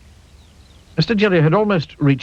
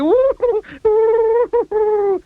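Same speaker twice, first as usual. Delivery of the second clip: high wail; 4 dB louder than usual. A narrator's reading. Fainting wail.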